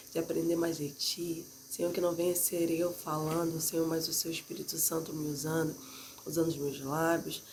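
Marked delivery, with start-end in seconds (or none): none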